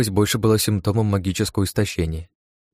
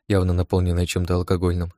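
usual speaking voice

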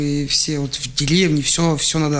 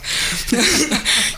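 amused laugh; 8 semitones higher than usual. Real, not acted. Laughs at KamAZ wheel.

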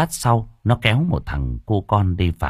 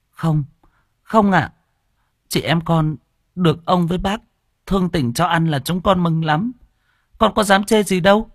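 much higher, hasty denial; flustered girl; 11 semitones higher than usual. Acted. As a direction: protest quickly like a little girl. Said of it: "rối rít xua tay". (bé gái rối rít chối, giọng cao)

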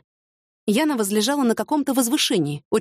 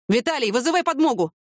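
higher-pitched, contained shout; urgent call for help, little added volume; 5 semitones higher than usual. A narrator's reading. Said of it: "крикнул Николай". (сдержанно выкрикнуть)

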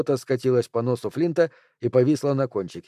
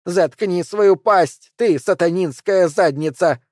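higher, louder, slow spat insult; furious outburst; 5 semitones higher, 4 dB louder, slower than usual. A narrator's reading. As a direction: lash out at him. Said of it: "разразилась в его сторону женщина".

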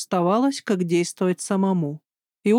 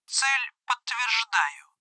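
robot voice; 2 semitones higher, 6 dB quieter, much slower than usual. Acted. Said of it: "ответил робот".

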